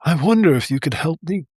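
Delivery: mildly pitiful frog plea; a touch of pathetic whine; sad and pathetic. A narrator's reading. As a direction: ask for help like a sorry little frog. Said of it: "the frog with the sad and pathetic voice".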